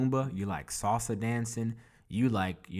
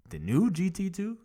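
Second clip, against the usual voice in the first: jumps way up, louder, amazed; excited disbelief; 12 semitones higher, 6 dB louder than usual. Retold or imitated. Stunned to hear a soccer buddy ordered a rare car, excited disbelief. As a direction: hit the word new high in disbelief.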